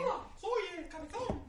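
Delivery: high, slow squeaky puppet voice. Pretend. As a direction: make the little figure talk in a silly high voice. Voicing the big-headed figure.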